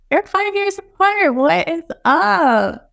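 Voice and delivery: high-pitched mock exasperation, drawing out the end; exasperated parent. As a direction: plead with bickering kids in mock disbelief. Acted exasperated parent to squabbling kids.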